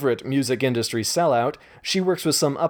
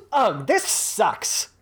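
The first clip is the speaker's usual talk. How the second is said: slow, higher frustrated wail with a laugh; shouted; 8 semitones higher, much slower than usual. Acted. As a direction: whine it out long. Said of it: "Gretchen suddenly shouted".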